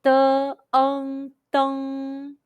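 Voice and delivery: "De, ong, dong" sounded out piece by piece, high and level; high first tone. Shown as a demonstration. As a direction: hold "De", "ong", then blended "dong" on one flat tone. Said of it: "high pitch first tone all together".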